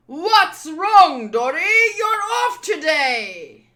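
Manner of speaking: loud booming shout; full-voiced, hearty combat holler; battle taunt. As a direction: shout a challenge across a battlefield. Giant taunts opponent mid-battle.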